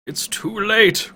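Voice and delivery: high, slow broken cry; old man sinking to his knees; despairing cry. Acted. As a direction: collapse with the words. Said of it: "cries Pentagon, sinking to his knees".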